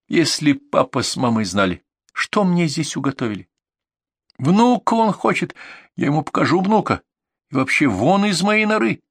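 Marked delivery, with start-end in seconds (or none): 4.4-9.0 indignant rant, pitched high; гневная тирада. Acted. Я орала то на английском. (возмущённо, на повышенных тонах)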